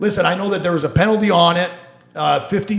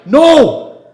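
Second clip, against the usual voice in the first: emphatic shout; 10 semitones higher, 11 dB louder than usual. Real, not acted. Answering own rhetorical question.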